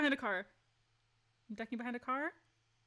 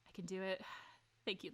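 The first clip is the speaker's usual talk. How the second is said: ordinary talk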